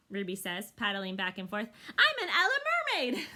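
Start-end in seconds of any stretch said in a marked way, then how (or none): 1.9-3.3 put-on playful child elephant. Young elephant plays.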